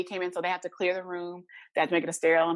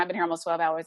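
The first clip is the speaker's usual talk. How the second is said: normal talking voice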